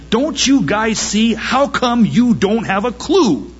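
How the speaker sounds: can't believe they miss it; exasperated plea. Retold or imitated. Preacher voicing the other side, exasperated plea. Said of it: "And they say".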